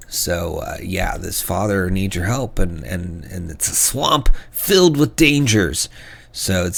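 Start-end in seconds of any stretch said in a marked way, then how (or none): none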